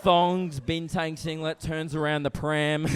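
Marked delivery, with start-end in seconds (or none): none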